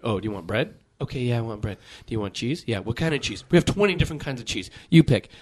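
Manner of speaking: low mumbled imitation of a server quizzing customers; sandwich server. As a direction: drone through the choices like a tired server. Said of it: "you went and you went".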